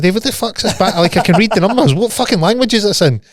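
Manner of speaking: high-pitched, incredulous demand for an explanation; retold bafflement. Friend baffled by a bank statement he can't read, incredulous. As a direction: act out being utterly baffled, voice pitched right up.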